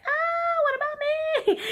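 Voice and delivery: very high, whiny mock cry; hammed-up "poor me" squeal; mock wail. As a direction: wail it in a tiny high self-pitying voice. Mock wail at others' pregnancy announcements.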